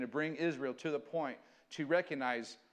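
normal talking voice

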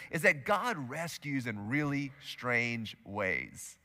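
quiet, slow key idea; slow, soft statement. Shown as a demonstration. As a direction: let God rescues in really strange ways sink in quietly. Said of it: "I want you to write this down".

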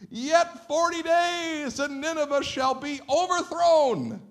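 very high, slow, drawn-out shout; prophet calling out. Acted. Preacher repeats Jonah's short message to Nineveh, drawn-out shout. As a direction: holler each word long and high. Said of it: "eloquent words of Jonah's message".

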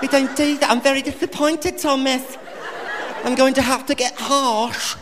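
high mock English nanny voice, gently disappointed; English nanny, calm. Comic playing the Super Nanny calmly scolding Thomas, in an English accent. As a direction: keep it prim and unbothered as a nanny. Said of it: "she never loses her cool".

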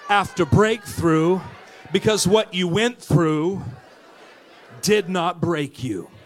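loud, slow, building preacher's cry; preaching at full force. Real, not acted. Pastor rousing the church to stand, full-force preaching. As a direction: drive it home to the congregation.

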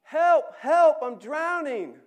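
frantic high scream for help; desperate drowning cry; shout. Acted. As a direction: yell as if going under. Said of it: "Do I hear a scream?".

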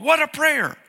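ordinary talk, nothing marked

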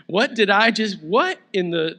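loud amazed disbelief; stunned. Pretend. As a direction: gasp out what you just saw. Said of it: "That's what I'd be thinking".

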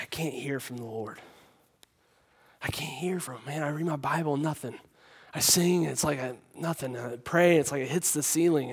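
hushed, low imitation of tearful despair; retold crying. Young man at camp confiding in the preacher, retold crying. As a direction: voice a crying young man, softly, half-whispered. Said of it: "he's, like, crying".

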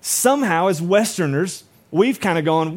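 ordinary talk, nothing marked

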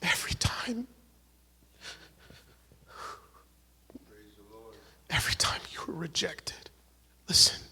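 tender, whispered comfort over the congregation; hushed whisper. Real, not acted. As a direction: lean in and breathe the words to the hurting. Emotional ministry moment, hushed.

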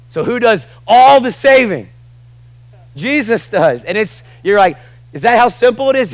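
high, loud, fervent preaching; preaching at full force. Acted. Preacher shouting no condemnation to the church. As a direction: lift the room with every word.

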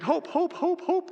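high, quick repeated calls; high-pitched running cat's call; cat calling owner. Acted. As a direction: chirp the name over and over. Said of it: "she's calling the name of her, uh, her owner".

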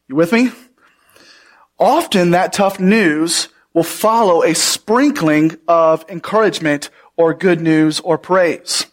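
ordinary talk

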